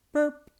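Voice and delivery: sudden loud, high little burp. Acted. Narrator voicing the small bear, a loud burp. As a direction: pop out a cartoon burp.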